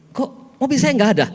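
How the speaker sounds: high, loud acted dismay; alarmed disbelief. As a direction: exclaim like someone whose car has vanished. Man finds his car missing.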